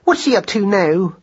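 high, anxious little question; mildly exclaimed question in a high voice; crying out. A narrator's reading. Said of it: "cried Madeline".

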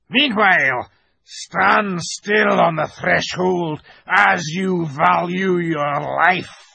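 slow, menacing wolf growl; loud, high beastly warning. A narrator's reading. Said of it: "said in a growling voice".

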